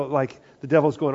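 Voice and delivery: ordinary delivery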